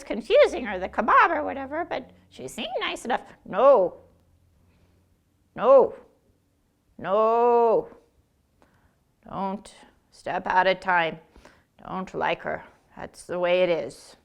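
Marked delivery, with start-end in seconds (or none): 3.4-14.2 stern elder. Acted. Stern refusal.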